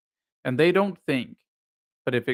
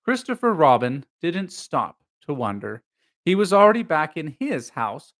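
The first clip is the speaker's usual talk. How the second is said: plain reading voice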